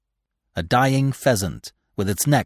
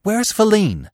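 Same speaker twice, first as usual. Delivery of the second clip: high, loud urgent question; impatient; 12 semitones higher, 5 dB louder, slower than usual. A narrator's reading. Terrified Bambi questions Gobo about Feline, impatient. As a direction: press for an answer, voice raised. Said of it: "Bambi spoke quickly and impatiently".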